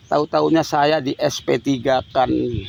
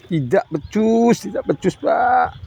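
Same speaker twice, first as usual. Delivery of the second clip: furious outcry; 5 semitones higher than usual. Real, not acted. Father denouncing negligent police to the national police chief, furious shout. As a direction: hurl the accusation at the police chief.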